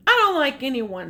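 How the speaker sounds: loud, fast grumpy snap; grumpy Smug. Acted. Reading aloud, Smug grumbles about the baby, loud and fast. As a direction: bark it out quick and sulky. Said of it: "said Smug".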